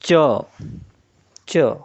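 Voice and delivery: च said as a crisp, isolated ch sound; teacher models च as a clean ch; ch sound demo. Shown as a demonstration. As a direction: say च twice, slowly, as the pure sound. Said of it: "इसकी जो खुद की आवाज़ होगी, वो होगी".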